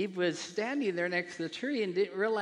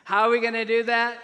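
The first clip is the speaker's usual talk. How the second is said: very loud, high call to the congregation; insistent repeated question; 6 semitones higher, 11 dB louder than usual. Real, not acted. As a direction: belt the question so everyone gets it. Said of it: "I'll ask it again so you can get it".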